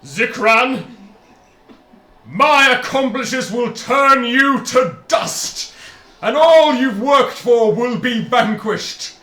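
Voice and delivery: loud, high, slow threatening genie. Acted. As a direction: declare it like a menacing genie.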